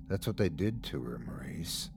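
hushed, sinister whisper. A narrator's reading. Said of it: "Uncle Scratch whispered".

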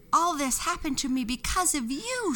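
high accusing witch shout; high furious witch voice; angry shout. A narrator's reading. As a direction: hurl the blame at the angel. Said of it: "she shouted".

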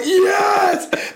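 high joyful cry; ecstatic shout. Retold or imitated. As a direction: celebrate the final whistle. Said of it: "I was just like".

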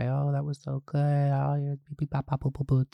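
soft, cheering teacher voice; sweet teacher voice. Retold or imitated. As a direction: praise her gently like a proud teacher. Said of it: "she came and was like".

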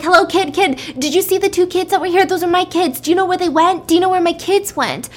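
high, frantic questioning; panicked yelling. Retold or imitated. Mother asking a little girl about her missing kids, panicked. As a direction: press a child urgently for answers, acted panic. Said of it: "like, yelling at her".

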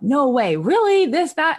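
high, loud mock disbelief from the kids; amazed kids. Kids gasping at the movie, high and loud disbelief. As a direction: play the stunned kids, voice jumping up high.

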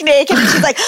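normal talking voice

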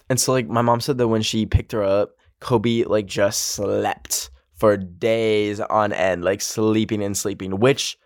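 ordinary delivery

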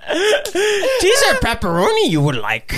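high, slow Yoda voice, laughing; squeaky laughing Yoda. Pretend. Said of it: "answers in Yoda style, laughing".

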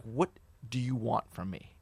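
quiet demand. Retold or imitated. Comedian asks caller what he wants, quietly. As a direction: imitate weary, hushed confrontation. Quiet after tirade on the phone.

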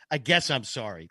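low, grudging mock sorry; half-hearted apology. Woman apologising to the man she wronged, half-hearted. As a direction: imitate a reluctant apology, pitched low. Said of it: "Like, that kinda sorry".

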